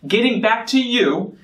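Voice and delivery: "you" leaned on and falling at the end; stress on you. Shown as a demonstration. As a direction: hit the word "you" so it lands on them. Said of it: "really think about emphasizing the word you".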